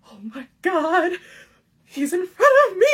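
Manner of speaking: high, loud fangirl gasp; starstruck gush. Retold or imitated. Fan spotting her crush in line, high starstruck gush. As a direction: squeal it like a starstruck teen. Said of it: "I'm also having the".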